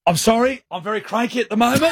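high-pitched, hammed-up crankiness; very cranky. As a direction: re-enact barely contained irritation, pitched high. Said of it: "'cause I'm so cranky".

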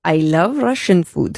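slow, low attempt at a Chinese accent; fake Chinese accent. On a dare to do an accent, in a shaky-aim Chinese accent. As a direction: put on a Chinese accent, slowly, sounding rather Russian. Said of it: "do it in a Chinese accent".

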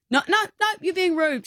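high scolding mother; high mock-stern mum. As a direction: tell the boy off, pitch up. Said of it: "Violet's like".

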